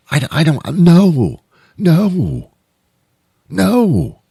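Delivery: backing away in alarm; high-pitched alarmed protest; panicked refusal. Acted. Panicked passenger refusing the car.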